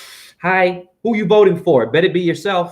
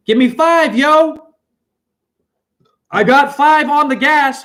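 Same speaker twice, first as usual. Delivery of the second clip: hyped call out; 9 semitones higher, 5 dB louder than usual. Real, not acted.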